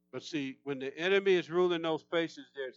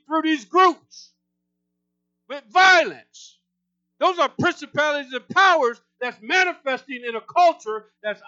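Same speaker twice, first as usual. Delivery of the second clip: much louder and higher, full-force preaching; intense preaching yell; 8 semitones higher, 9 dB louder than usual. Real, not acted. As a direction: preach it at the top of your lungs, rousing the church. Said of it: "Guys, I get intense".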